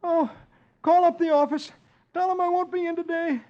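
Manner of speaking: high, loud put-on sick groan; moaning fake sick. Dagwood fakes illness to his wife, high moaning voice. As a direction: ham up a dying man. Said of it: "looks as if he were dying and moans".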